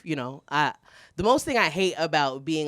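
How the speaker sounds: normal talking voice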